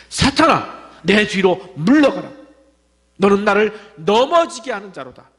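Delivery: angry rebuke. Acted. The Lord rebuking Peter, loud stern command. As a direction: drive him back with the words. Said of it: "어떻게 분노하셨습니까".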